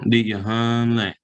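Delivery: "hun" held and running on into "lay"; no glottal stop. Shown as a demonstration. Teacher showing the answer without glottal stop, "hun" held into "lay". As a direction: keep the voice going through "hun" into "lay". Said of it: "there's no glottal stop there. So you just, you know, you keep going".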